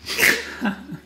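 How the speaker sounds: high-pitched laugh; short squeal of laughter. Real, not acted. Laughing after dog joke.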